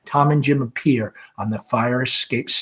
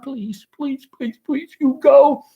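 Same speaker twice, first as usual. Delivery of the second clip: much higher, breathy pleading; panicked begging; 12 semitones higher, 6 dB louder than usual. Acted. Laura begs mother to go, panicked. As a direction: beg frantically.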